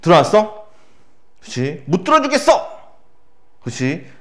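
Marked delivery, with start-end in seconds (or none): 1.8-2.8 high-pitched mock outburst of refusal; dramatized, emphatic no; acted blunt refusal. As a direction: refuse outright, voice jumping up high. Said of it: "거부가 직접적으로 표출됐다".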